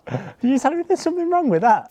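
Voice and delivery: hammed-up incredulity; mock outrage. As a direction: act scandalised, voice jumping up. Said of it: "mock outrage".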